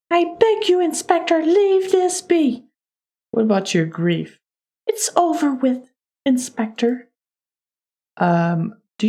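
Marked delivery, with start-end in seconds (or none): 0.1-2.7 high-pitched lady, slowing at the end; pleading lady suspect. Acted. 4.9-7.1 high-pitched dismissive lady; lady suspect. Acted. Voices the lady's answer.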